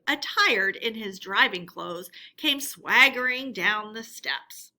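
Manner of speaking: ordinary delivery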